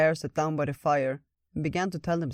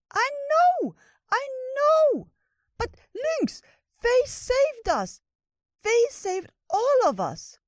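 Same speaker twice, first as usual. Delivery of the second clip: very high, loud pleading squeak; distressed little critter; 6 dB louder, much slower than usual. Acted. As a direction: plead in a squeaky wail, drawing words out.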